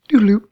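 high loud singsong; silly singsong doodaloo. Pretend. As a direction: sing it out goofily. Silly sung-out filler sound.

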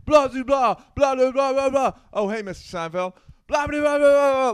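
Tracy impression. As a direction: rattle off nonsense high and loud like Tracy. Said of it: "somebody did an impression of Tracy".

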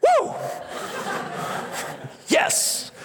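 high, fast hyped-up showman. Pretend. Preacher imagining himself as Jesus healing for an audience, showing off. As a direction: whip up the crowd, then whoop. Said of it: "if I was Jesus. I would have been like".

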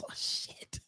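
muttered oh shit under breath; whispered curse. Real, not acted. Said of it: "I just closed all of my damn windows".

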